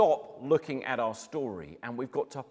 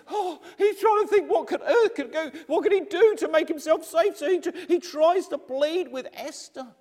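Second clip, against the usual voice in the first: frantic narration; 13 semitones higher, 7 dB louder than usual. A narrator's reading. Narrating Haman's panic.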